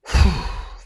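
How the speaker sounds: long blown-out breath; recovering sigh. Retold or imitated. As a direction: exhale to steady yourself, retold. Acts regaining control after crying.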